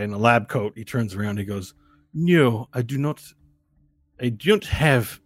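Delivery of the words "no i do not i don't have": deliberate mock-Russian delivery; Russian accent. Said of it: "With a Russian accent".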